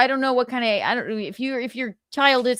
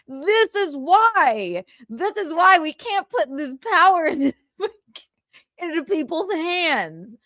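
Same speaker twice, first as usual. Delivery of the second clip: high, drawn-out plea through laughter; horrified laughing; 7 semitones higher than usual. Real, not acted. Host saying why people can't have this power, laughing. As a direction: wail it slowly, breaking into laughs.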